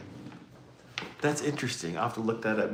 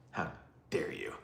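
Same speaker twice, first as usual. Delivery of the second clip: hushed, low mock outrage; whispered fake reproach; mock scolding; 8 semitones lower, 13 dB quieter than usual. Host teasing dieters for enjoying food, whispered mock scolding.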